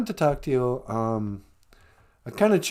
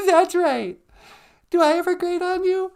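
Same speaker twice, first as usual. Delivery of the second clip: higher, teasing wifely voice; mock sweet wife; 14 semitones higher than usual. Wife teases her husband, high sing-song voice. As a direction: needle him playfully.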